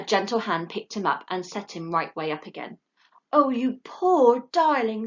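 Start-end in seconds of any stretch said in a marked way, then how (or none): 3.3-5.1 put-on slow, tender young woman; young lady comforting a fallen owlet, sweet. A narrator's reading. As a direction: coo over him. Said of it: "said a sweet young voice".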